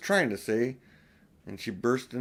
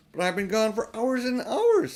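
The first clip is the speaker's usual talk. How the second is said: put-on higher, eager girl's voice; Lucy protesting, higher and urgent; young Lucy; 9 semitones higher, 4 dB louder than usual. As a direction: plead like an earnest little girl. Lucy voiced higher than narration.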